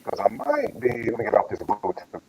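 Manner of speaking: ordinary talk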